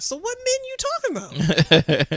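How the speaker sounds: retold confrontation. Woman confronting coworker who excused men's talk, emphatic challenge.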